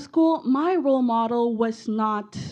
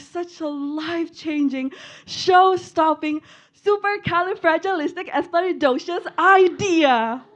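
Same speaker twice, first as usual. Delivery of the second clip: rising, louder with each word; excited; 6 semitones higher, 5 dB louder than usual. Real, not acted. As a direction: build it up to a big finish. Building excitement over idea.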